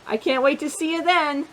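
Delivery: plain reading voice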